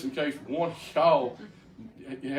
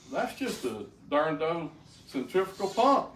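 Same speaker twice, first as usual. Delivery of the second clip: laughing; 5 semitones higher, 3 dB louder than usual. Retold or imitated. Mocking the mechanical heart.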